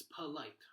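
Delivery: polite said as puh-LITE; quick short U. Shown as a demonstration. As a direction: make the first vowel of polite a quick schwa. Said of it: "sounds like a very quick short U".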